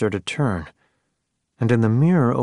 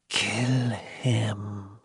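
put-on soft, eerie old crone whisper; old woman whispering; 6 dB quieter than usual. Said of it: "the old lady mouth whispered".

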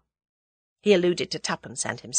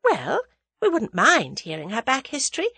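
put-on guarded, higher-pitched young woman; 7 semitones higher than usual. A narrator's reading. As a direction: answer cautiously, giving little away. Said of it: "said Tuppence guardedly".

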